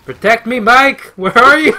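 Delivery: calling out. Real, not acted. Calling teammate in game.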